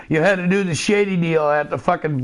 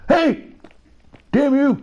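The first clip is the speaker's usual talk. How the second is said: mock outraged old man; grandpa shouting; 10 semitones higher than usual. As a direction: shake your fist as a cranky grandpa. Grandpa yells at youngster.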